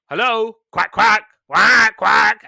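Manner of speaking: high, loud cartoon quacks; quacking duckling. Podcaster imitates duckling following the family, loud quacking. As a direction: greet eagerly, then honk out quacks. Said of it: "He's just basically going".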